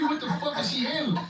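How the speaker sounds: high-pitched disbelief; comic outrage, voice jumping up; incredulous inner voice. Retold or imitated. Caller silently questioning the excuse, high incredulous outrage. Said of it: "I'm saying in my mind".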